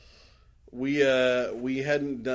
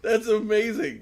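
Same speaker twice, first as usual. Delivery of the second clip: mock amazement; 10 semitones higher, 8 dB louder, slower than usual. Real, not acted. Mock delight at tie-in novel.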